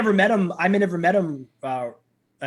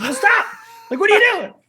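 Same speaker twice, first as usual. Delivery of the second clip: much higher, loud disbelieving yell; mock yell; 9 dB louder than usual. Passenger yelling at a driver who won't pick a lane, high and loud. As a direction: throw your hands up and shout it.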